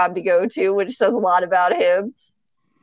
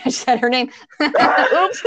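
ordinary talk

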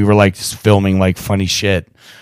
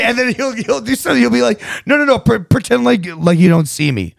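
lightly voiced bossy little boy, higher; kid voice; 11 semitones higher, 4 dB louder than usual. Dad imitating his son giving directions, higher kid voice. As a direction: boss dad around like a tiny director.

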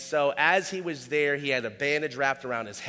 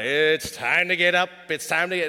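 the wake-up chant again, pushed louder; louder wake-up call; 5 dB louder than usual. Retold or imitated. Grandpa calling the unmoving kid, louder sing-song. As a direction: sing it out louder to a kid who won't move. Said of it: "he'd go a little louder".

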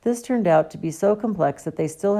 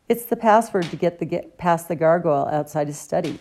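ordinary delivery

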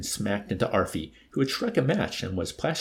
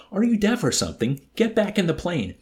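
high, exasperated demand; exasperated yelling; 10 semitones higher, 5 dB louder than usual. A narrator's reading. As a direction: yell at someone who won't listen. Desperate yelling at deaf Arfie.

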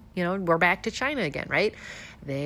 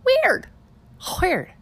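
high, loud sarcastic weird; mock surprise; 12 semitones higher, 5 dB louder than usual. Real, not acted. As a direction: feign being baffled.

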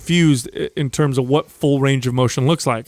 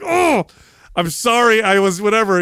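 mock sincere apology; 6 semitones higher than usual. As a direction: overplay the sorry so it sounds exaggerated. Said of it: "Say it like you mean it".

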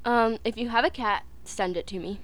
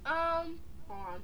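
talking while chewing; mouth full; 5 semitones higher, 8 dB quieter than usual. Real, not acted. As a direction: speak through a mouthful of food. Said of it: "She's chewing".

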